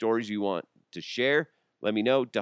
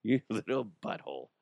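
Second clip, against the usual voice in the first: exasperated dad; 4 semitones higher than usual. Retold or imitated. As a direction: drag it out, fed up. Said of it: "I'm like".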